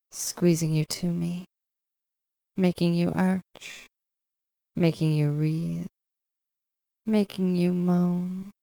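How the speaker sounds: ordinary talk, nothing marked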